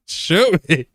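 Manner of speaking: high, loud "shoot me" dare; taunting shout. Real, not acted. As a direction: yell it up at the enemies. Daring enemies while laughing.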